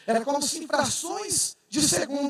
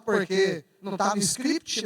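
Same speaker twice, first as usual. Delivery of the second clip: normal talking voice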